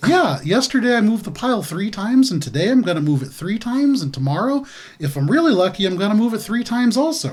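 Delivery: high, swooping sing-song voice; eager simple character. Acted. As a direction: report it proudly like a little kid. Player voices Glub character.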